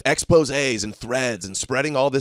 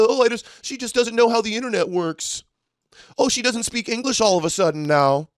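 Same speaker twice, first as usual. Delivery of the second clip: much higher, whiny mock voice of her excuses; 10 semitones higher than usual. Host mocking the exposed account's excuses, high and sneering. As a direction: sneer her lame excuses back in a silly voice. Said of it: "Trying to play it off".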